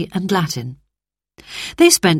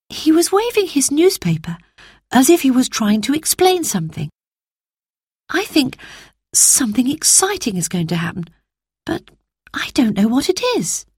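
put-on girl voice; 7 semitones higher than usual. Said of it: "explained Roberta".

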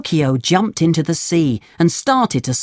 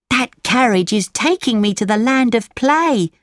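put-on boy voice; 7 semitones higher than usual. Lampwick voiced in story.

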